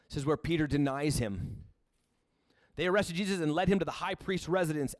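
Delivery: ordinary talk, nothing marked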